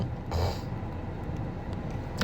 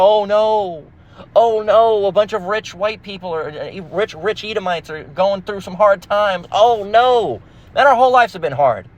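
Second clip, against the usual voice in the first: higher sarcastic oh no; high mocking fake concern; mock pity; 7 semitones higher, slower than usual. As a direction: fake sympathy, laying it on.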